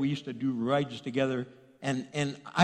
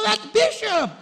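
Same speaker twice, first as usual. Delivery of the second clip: very high, loud exclamation; hammed-up shock of recognition; astonished; 7 dB louder, slower than usual. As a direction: blurt it out in squeaky disbelief. Acting out his own startled recognition.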